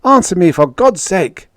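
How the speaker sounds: husband hearing screams, frantic and loud. Acted. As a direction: beg for an answer, frantic. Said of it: "demanded frantically".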